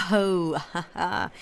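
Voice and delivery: a light derisive laugh; mocking laugh. The Signora laughing at the beaten Countess, light mock laughter.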